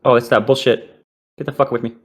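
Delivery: ordinary talk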